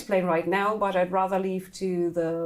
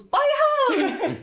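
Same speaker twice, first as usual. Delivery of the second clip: very high female voice, raised; high-pitched female cry; 4 dB louder than usual. Acted. Unseen woman shouts amid the fighting, high-pitched. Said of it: "female high-pitched voice that goes".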